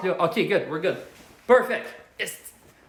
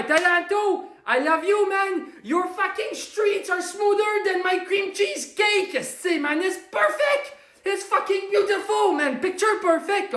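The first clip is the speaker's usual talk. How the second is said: high-pitched, adoring rave; excited gushing; 7 semitones higher than usual. Acted. Host gives Toronto a shout-out, excited gushing. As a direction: gush in a high voice like a smitten fan.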